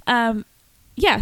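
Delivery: ordinary delivery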